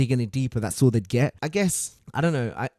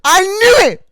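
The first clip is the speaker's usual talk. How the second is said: real high-pitched victory shrieks; triumphant screaming; 15 dB louder than usual. Real, not acted. Streamer busting his impersonator, triumphant screaming. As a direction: scream it at the top of your voice, elated.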